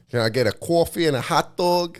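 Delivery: amateur Jersey impression ordering at a counter; Jersey accent. Shown as a demonstration. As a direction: put on a broad New Jersey accent. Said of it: "Do you wanna hear my Jersey accent?".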